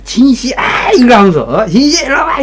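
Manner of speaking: very high, loud sloppy call; drunk calling out. Retold or imitated. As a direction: yell to her like a pushy drunk. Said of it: "진이라고 합시다".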